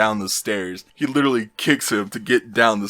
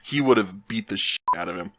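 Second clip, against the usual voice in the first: ordinary delivery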